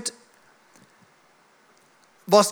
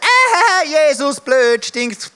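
high, loud taunt of a first-grade schoolboy; mocking schoolmate; 6 semitones higher, 4 dB louder, much slower than usual. Schoolmate mocking the boy's Jesus T-shirt, loud childish jeer. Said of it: "dann kommt mein Kollege und sagt".

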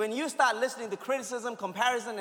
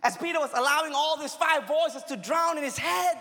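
full-force preaching; 6 semitones higher than usual. Acted. Preaching the Peter story.

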